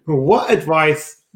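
normal talking voice

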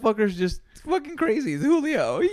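high and loud, mock amazed; hyped friend voice. Friend hyping him up, loud and high. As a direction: cheer it like a wild buddy. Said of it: "enough to be like".

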